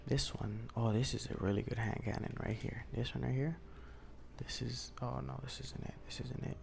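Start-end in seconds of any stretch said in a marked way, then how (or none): 4.4-6.6 hushed, keeping it down; quiet late-night voice. Real, not acted. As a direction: talk softly so nobody wakes.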